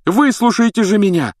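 high, loud demand; fuming. A narrator's reading. Harry demanding the adults listen, fuming. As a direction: demand hotly to be heard. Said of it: "пылил Гарри".

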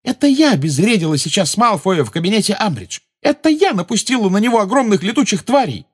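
loud, high-pitched indignant protest; indignant, insistent self-defence at high pitch; heated outburst. A narrator's reading. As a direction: argue hotly, high and forceful. Heated boast in a quarrel.

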